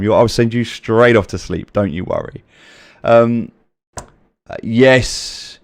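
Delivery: ordinary talk, nothing marked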